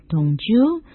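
"don't you" with a climbing end; rising intonation. Shown as a demonstration. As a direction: show the upward tune on "don't you". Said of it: "老师是用上扬的语调".

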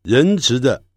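ordinary delivery

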